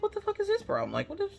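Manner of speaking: baffled outburst. Retold or imitated. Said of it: "I'm like".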